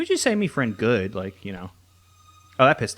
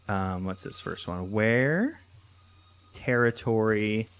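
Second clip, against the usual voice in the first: usual speaking voice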